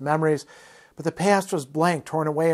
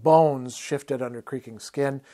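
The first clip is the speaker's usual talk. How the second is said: usual speaking voice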